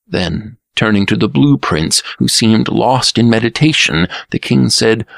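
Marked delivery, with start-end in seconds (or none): none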